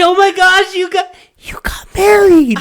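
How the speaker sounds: very high, strained, loud squeal; mock hushed scream, far too loud; failed attempt at quiet. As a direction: imitate a coworker bursting with a secret. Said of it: "she's trying to be quiet about it".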